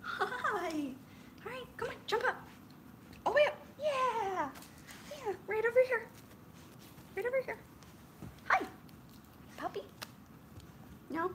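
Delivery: high, sing-song voice for the dog; pet talk. Acted. Coaxing the dog onto the couch, high pet talk. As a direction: coax the puppy up high and sweet.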